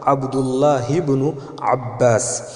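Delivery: عبداللہ ابن عباس drawn out slowly as abdullahi-bnu abbas; very slow عبداللہ ابن, ہ joined into ب with no glottal stop; Arabic liaison. Shown as a demonstration. Teacher carefully modelling the joined reading of عبداللہ ابن عباس. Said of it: "ہا کے نیچے زیر اور ہا کو با کے ساتھ ملا کر کے پڑھیں گے".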